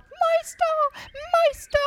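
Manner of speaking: very high, squeaky little cry; piping alarm call; harp calling loudly. A narrator's reading. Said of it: "rief die Harfe ganz laut".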